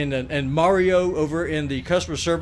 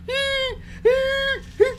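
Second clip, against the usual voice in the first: high whimpering puppy cry; mock dog whimper, much higher; imitated dog whine; much slower than usual. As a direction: whine like a crying old Yorkie. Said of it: "He says".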